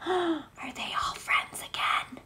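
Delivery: gasped, breathless whisper of delight; excited whisper. Real, not acted. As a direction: whisper it as if the news is too good. Gasping at the picture.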